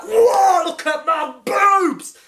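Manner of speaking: whoa, shouted high and slow in delight; mock astonished shout. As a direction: ham up a shocked new body. Imagined new female Doctor exclaiming.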